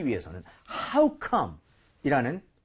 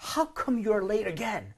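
softer, exasperated How come you're late again; fed-up, puzzled complaint; emotional exasperation; 4 semitones higher, 4 dB quieter, much slower than usual. Shown as a demonstration. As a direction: ask "How come" like you can't believe it. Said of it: "이렇게 더 감정적으로 나간다는 거죠".

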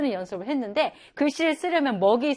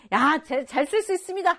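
confident son; 6 semitones higher, 4 dB louder than usual. Acted. Son voiced.

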